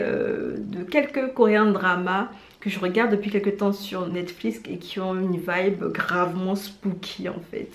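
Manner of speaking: normal talking voice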